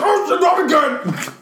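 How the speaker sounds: high, slow comic shout; mock dismay. Friend retelling the kryptonite hit, mock wail. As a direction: wail it for laughs, high and loud.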